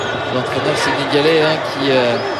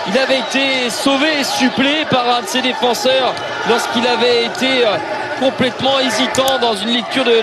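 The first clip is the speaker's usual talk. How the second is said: excited shout; 8 semitones higher than usual. Real, not acted. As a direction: shout the keeper's blunder live. Keeper's failed punch, live call.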